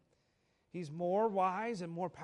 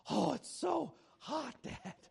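put-on high, drawn-out whine of an overheated kid; complaining child; 7 semitones higher, slower than usual. Son complaining to his dad about the heat, whiny.

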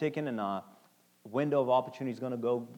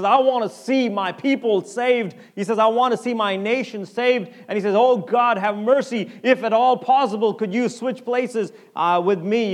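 impassioned preaching; 8 semitones higher, 10 dB louder than usual. Acted. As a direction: cry out the prayer from the pulpit. Preacher voicing Paul's plea.

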